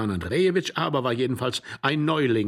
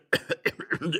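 rasping cough; coughing fit; 5 semitones higher than usual. A narrator's reading. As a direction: break into a cough. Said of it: "neuen Hustenanfall".

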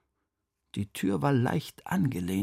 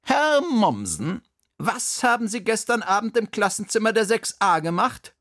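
high, louder headmistress voice, calling to account; stern headmistress; 10 semitones higher, 3 dB louder than usual. A narrator's reading. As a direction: question him like a strict headmistress. Direktorin stellt den Hausmeister zur Rede. (strenge, hohe Stimme einer Direktorin)

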